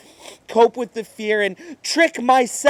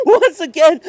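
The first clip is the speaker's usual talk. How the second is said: laughing; 7 semitones higher, 6 dB louder than usual. Acted. Laughing through despair.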